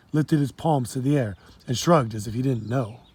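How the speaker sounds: usual speaking voice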